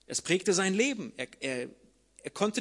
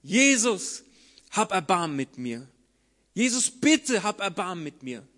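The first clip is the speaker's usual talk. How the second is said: loud pleading call; 5 dB louder than usual. Acted. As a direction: cry out for mercy across the road. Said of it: "Und er fängt an zu rufen". (laut rufend, flehend)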